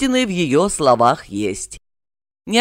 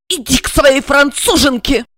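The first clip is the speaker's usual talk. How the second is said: hateful hiss; 8 semitones higher than usual. A narrator's reading. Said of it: "с ненавистью прошипела".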